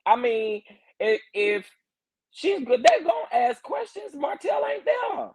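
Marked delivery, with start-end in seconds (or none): 2.3-5.3 very high-pitched exasperated protest. Real, not acted. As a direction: argue it way up high, incredulous. Exasperated argument with critics.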